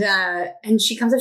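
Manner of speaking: ordinary talk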